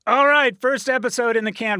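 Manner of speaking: loud excited wrap-up. Real, not acted. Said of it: "loud wrap-up".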